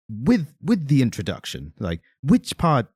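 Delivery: halting impression with odd stresses; Christopher Walken. Host retells Walken asking about an introduction, Walken impression. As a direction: put the emphasis in strange places, pausing mid-thought. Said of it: "trying to do my best Christopher Walken accent".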